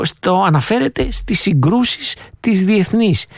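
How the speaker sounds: normal talking voice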